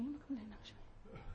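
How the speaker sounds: very soft, fading to a whisper; muttered denial. Acted. Preacher acts someone caught complaining, muttered denial. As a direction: mutter it under the breath.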